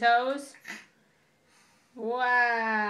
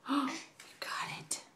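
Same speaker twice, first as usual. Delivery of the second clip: soft playful whisper; 4 semitones higher, 10 dB quieter, slower than usual. Real, not acted. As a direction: whisper it like a secret.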